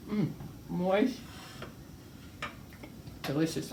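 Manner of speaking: talking through a mouthful of bread; chewing while speaking; mouth full. Real, not acted. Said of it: "talk with my mouth full".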